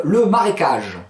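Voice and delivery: ordinary talk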